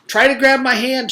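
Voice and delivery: high, loud urgent call; girl calling down urgently, high and loud. Acted. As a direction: reach out and call. Leslie urges.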